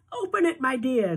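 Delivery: put-on Grandma Georgina urging Charlie, high old-lady voice; grandmother voice. A narrator's reading. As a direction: urge him sweetly like a granny.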